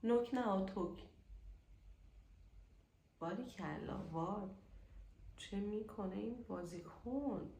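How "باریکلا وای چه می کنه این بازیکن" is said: ordinary delivery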